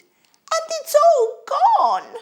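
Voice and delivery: put-on very high, loud, slow baby bear wail; squeaky wail. A narrator's reading. Baby Bear mourns his porridge, slow squeaky wail. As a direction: draw it out in a piercing little squeal. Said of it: "he squeaked".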